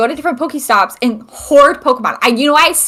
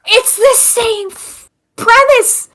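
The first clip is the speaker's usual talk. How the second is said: exasperated; 10 semitones higher, much slower than usual. Real, not acted. Exasperated rant.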